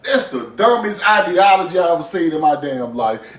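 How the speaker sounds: ordinary talk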